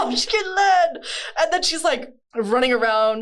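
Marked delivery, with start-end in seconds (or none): 0.1-1.0 high, slow, laughing wail; echoed mock wail. Friend echoes the joke, high laughing wail. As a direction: copy the hammed-up cry.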